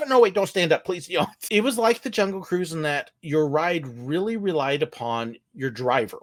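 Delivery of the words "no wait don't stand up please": raised, pleading mock alarm; goofy mock panic. Skipper hastily correcting the riders, goofy panic. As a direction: backtrack in a pretend fluster. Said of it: "being really goofy".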